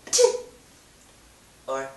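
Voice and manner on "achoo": high, loud fake achoo; fake sneeze. Shown as a demonstration. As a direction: squeal a hammy achoo. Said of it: "here's how you do it".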